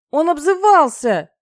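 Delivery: high, loud, drawn-out protest; aggrieved shout. A narrator's reading. Dragoness struggling in his grip excuses herself, aggrieved shout. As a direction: yell it like a wronged child tattling.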